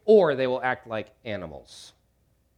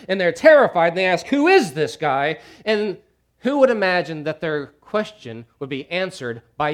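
loud, high, driving sermon delivery; preaching; 5 semitones higher, 9 dB louder than usual. Acted. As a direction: preach it at full force. Preaching at full force.

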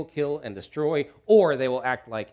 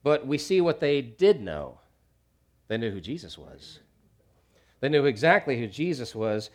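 ordinary talk

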